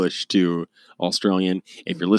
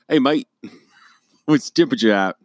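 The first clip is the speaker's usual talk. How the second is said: higher, hammed-up Australian accent; Australian mate asking, played for laughs; mock Australian; 5 semitones higher than usual. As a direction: put on a rough Australian imitation. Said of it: "That's Australian".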